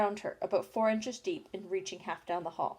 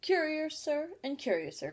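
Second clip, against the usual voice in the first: higher-pitched mild exclamation of surprise; surprised cry; 7 semitones higher than usual. A narrator's reading. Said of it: "cried Alice".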